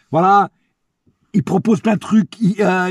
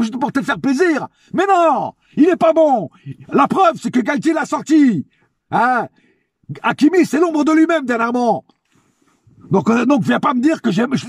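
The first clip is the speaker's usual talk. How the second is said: heated high-pitched rant; vent, pitch up and pushing hard; escalating comedy rant; 6 semitones higher than usual. Real, not acted. Heated rant about Hakimi.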